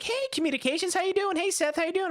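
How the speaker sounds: high, chipper greeting; friendly caller. Retold or imitated. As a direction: imitate an over-friendly phone caller. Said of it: "she was so friendly".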